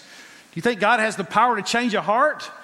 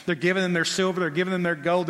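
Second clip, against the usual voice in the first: ordinary talk, nothing marked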